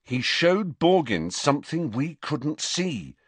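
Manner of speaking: high, slow, emphatic insistence; stubbornly pressing on. A narrator's reading. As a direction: press the point, drawing out each key word. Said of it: "pressed on stubbornly".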